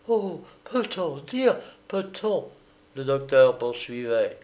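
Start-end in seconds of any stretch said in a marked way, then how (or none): 0.1-2.5 high, slow, breathless protest; a pale woman gasping out her denial; shocked cry. Acted. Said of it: "s'écria la Havraise en pâlissant". (cri choqué, aigu et lent)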